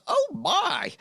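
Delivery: very high, loud shocked exclamation; hammed-up falsetto alarm; housekeeper's startled cry. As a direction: squeal it as an astonished housekeeper. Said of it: "And she sort of, like, says".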